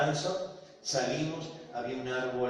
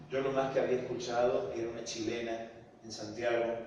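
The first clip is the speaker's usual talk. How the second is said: ordinary talk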